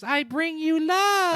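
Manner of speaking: high, slow, otherworldly voice; slow, eerie alien greeting. Retold or imitated. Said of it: "glows like the alien, goes".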